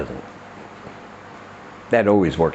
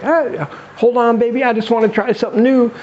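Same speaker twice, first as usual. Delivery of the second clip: put-on coaxing boyfriend; 12 semitones higher than usual. Acted man coaxing his startled partner.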